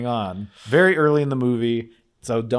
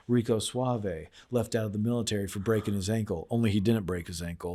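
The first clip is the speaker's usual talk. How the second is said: 1 low mysterious agent voice; 4 semitones lower, 10 dB quieter than usual. Retold or imitated. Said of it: "He goes".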